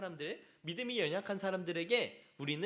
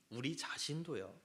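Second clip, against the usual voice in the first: usual speaking voice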